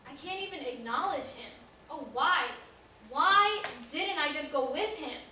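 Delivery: loud, rising cry of regret; anguished lament. Acted. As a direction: cry out to God in remorse, pitch climbing. Peter laments denying his Lord.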